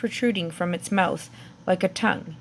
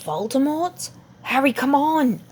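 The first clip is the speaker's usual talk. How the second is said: higher, pleading hurry; frightened urging; 6 semitones higher than usual. Acted. Hermione urging Harry to flee, frightened and high. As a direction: pull him away, scared and urgent.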